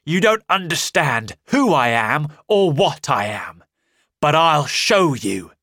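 loud, threatening voice; menacing stranger. Acted. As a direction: loom over them, loud and dark.

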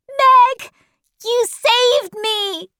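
put-on high, excited child's voice; crying out. A narrator's reading. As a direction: cry it high, drawing out the thanks. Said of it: "he cried".

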